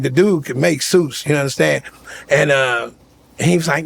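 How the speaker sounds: usual speaking voice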